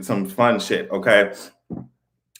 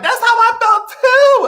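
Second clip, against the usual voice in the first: high, loud, slow emphatic agreement; 6 dB louder, slower than usual. Real, not acted. Agreeing with viewers about the finale, high and loud. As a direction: lean hard into it.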